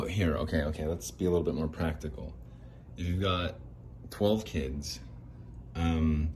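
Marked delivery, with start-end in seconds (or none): none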